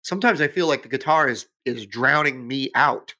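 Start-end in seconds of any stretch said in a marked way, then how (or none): none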